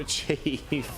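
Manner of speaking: usual speaking voice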